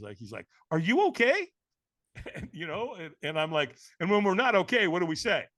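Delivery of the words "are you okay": puzzled, higher and louder check-in; concerned, incredulous question. Retold or imitated. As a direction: ask it loud and high, baffled. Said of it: "looking at me like a dog that hears a funny noise".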